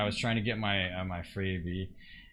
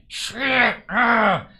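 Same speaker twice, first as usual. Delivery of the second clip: loud, high mock shout; sudden high-pitched yelp of swearing; imitated cussing outburst; 10 semitones higher, 10 dB louder than usual. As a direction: imitate someone blurting curses at full volume. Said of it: "they start cussing".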